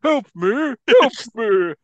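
high, loud cry for help; monster pleading. Retold or imitated. Monster begging for help, high and loud. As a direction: plead like a trapped beast. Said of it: "He just keeps on saying it".

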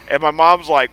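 usual speaking voice

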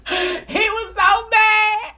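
helpless laughter, words dragged out slowly; slow down as real laughter takes over; laughing. Real, not acted. Podcaster recalling how mad he got, cracking up.

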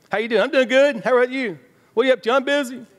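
high, loud, chirpy back-and-forth; mock the automatic everything's-fine chat; breezy small talk. People swap routine greetings to look okay, breezy.

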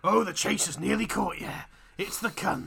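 put-on Cockney, playing up the chase. Host announcing the chaser is closing in, mock Cockney. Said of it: "it's the only Cockney voice I can do".

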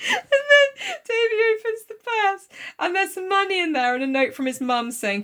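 ordinary talk, nothing marked

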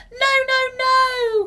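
very high, loud, drawn-out wail; pixie's cry. A narrator's reading. Pixie dreads the matchbox, high desperate cry. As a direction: wail in protest as a tiny creature. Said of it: "cried the pixie".

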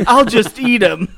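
loud, slow, laughing threat; laughing bear voice. Pretend. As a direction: ham up a hungry bear.

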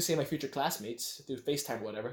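normal talking voice

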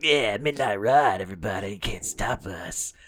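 slow, drawn-out late-night DJ; imitated FM swagger; radio DJ voice. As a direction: stretch it like a cocky midnight host. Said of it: "just kept like".

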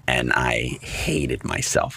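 slow and soft, still stung by it; rueful. Real, not acted. Recalling chickening out of the musical, slow and rueful. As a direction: drop low and slow with regret. Confessing old shame.

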